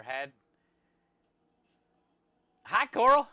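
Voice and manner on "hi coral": excited greeting. Real, not acted. Greeting a chat viewer.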